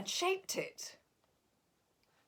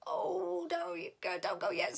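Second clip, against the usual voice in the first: pleading; 9 semitones higher than usual. Acted. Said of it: "said Figgy Two Socks".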